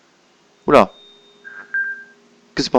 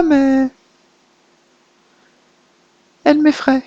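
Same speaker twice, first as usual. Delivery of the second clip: put-on high childlike girl's voice; little girl voice; 10 semitones higher than usual. The little girl confides fears about papa's new partner, childlike voice. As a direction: speak as a small timid girl.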